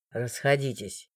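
cold authority, no need to shout; stern guard. A narrator's reading. The guard questioning the crowd, low stern voice. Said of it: "приказал стражник".

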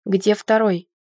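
firm repeated demand, falling at the end; mildly angry male supervisor; indignant demand. A narrator's reading. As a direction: demand it impatiently, dropping the final word. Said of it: "возмутился мужчина".

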